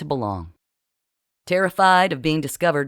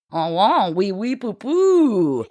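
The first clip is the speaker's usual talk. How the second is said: sing-song fake French nonsense, higher; boy mocking a French accent in a higher sing-song; 5 semitones higher, 4 dB louder, slower than usual. A narrator's reading. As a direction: lampoon a French accent lyrically. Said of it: "lyrical tone he felt imitated Marcel's accent".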